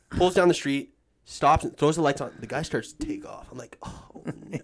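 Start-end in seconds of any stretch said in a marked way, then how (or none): 3.8-4.6 voiceless oh no; whispered dismay. Retold or imitated. Watching the drunk driver start to drive off, whispered. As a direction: breathe it out in dismay.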